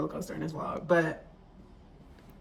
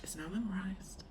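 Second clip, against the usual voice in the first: whispered, nearly gone, holding back tears; tearful whisper; 11 dB quieter than usual. Real, not acted. Telling viewers about her childhood church, tearful whisper. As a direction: let it fall to a breath, close to tears. Emotional memory.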